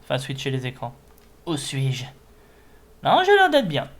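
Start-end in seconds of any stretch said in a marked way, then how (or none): 3.0-3.9 sudden high loud exclamation; excited. Real, not acted. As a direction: burst out in relief. Sudden outburst checking screens.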